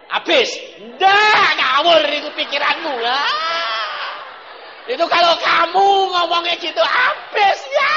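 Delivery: loud, high rebuke of a doubter; preaching shout. Acted. Preacher to congregation, shouting the doubter down.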